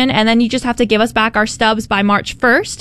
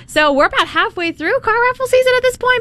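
much higher, lightly put-on Texan cowgirl accent; 13 semitones higher than usual. Cohost tries a Texan cowgirl accent for the update. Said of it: "the cowgirl accent".

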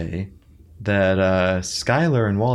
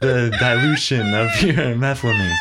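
mock kid voice; 4 semitones higher than usual. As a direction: play a bossy kid, giggling. Voicing the kid at train yard.

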